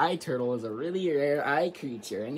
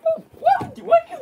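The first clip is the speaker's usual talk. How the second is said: startled shout; 10 dB louder than usual. Real, not acted. Toy startles the speaker.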